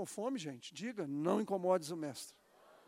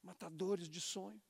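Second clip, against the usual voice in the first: soft; 9 dB quieter than usual. Shown as a demonstration. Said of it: "repeated phrase".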